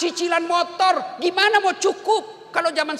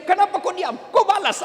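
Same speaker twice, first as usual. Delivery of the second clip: higher, louder, demanding; nagging wife; 6 semitones higher, 4 dB louder than usual. Acted. Wife scolds her silent husband, demanding an answer loudly.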